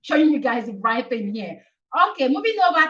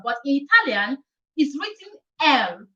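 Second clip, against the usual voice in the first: normal talking voice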